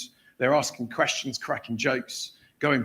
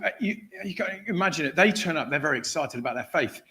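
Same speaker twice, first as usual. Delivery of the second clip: ordinary talk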